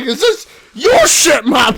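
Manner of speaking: loud, high, slow shouted question; teasing shout. Retold or imitated. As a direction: shout the joke at mum. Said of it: "I'm like".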